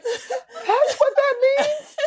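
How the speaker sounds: high, slow, laughing shock; shocked realization through laughter; mock surprise. Learning what the unicorn emojis mean, laughing shock. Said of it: "And I was like".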